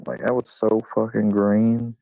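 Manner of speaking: ordinary delivery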